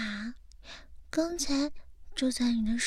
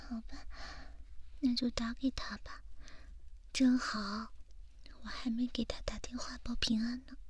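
whispering; 7 dB quieter than usual. Acted. ASMR whisper roleplay.

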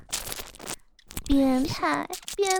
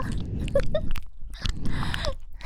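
airy mocking laugh; high scornful cackle; 13 semitones higher than usual. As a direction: laugh down at him. (轻蔑的尖笑)

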